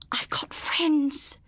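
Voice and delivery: a slow, terrified whispered croak. A narrator's reading. Eva bluffing the knife-wielding intruder, slow whispered croak. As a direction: force it out through a dry throat, barely voiced. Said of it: "she croaked".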